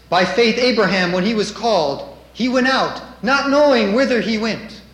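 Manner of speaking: scripture reading. Acted. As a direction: proclaim it boldly from the pulpit.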